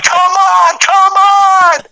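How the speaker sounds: very high, urgent cries of come on; pleading shout. Retold or imitated. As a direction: urge him on at the top of your voice. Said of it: "and I'm like".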